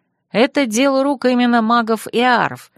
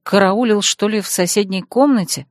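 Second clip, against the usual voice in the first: plain reading voice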